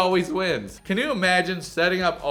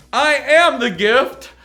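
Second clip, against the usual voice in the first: loud, slow, higher-pitched imitation; hammed-up diva declaration; mock self-important girlfriend; 5 semitones higher, 6 dB louder, slower than usual. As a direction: announce it grandly, word by word. Mocks Via's self-important attitude.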